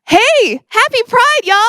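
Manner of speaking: high, bright hello to the crowd; excited greeting. Real, not acted. Performer greeting the Pride audience, excited.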